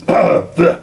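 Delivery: coughing after swallowing a bug; choked by a gnat, coughing; swallowed a bug. Real, not acted. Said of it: "j'ai avalé un moucheron".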